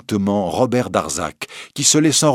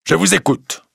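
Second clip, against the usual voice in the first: higher, louder curt judge; 8 semitones higher, 3 dB louder than usual. Acted. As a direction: say it short and dry. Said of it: "d'un ton sec".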